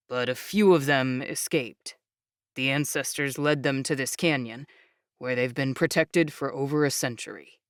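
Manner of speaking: low, calm proud explainer voice; proud young man. Acted. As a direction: speak low and proudly steady. Jake explains.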